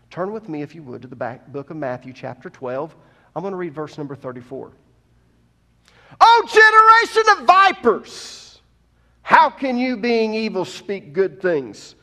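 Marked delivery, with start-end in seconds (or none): 6.2-11.9 loud, high and slow, hurling the accusation; booming condemnation of hypocrites; thundering rebuke. Shown as a demonstration. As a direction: shout it down at the crowd like an angry prophet. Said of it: "I kinda picture Jesus doing that".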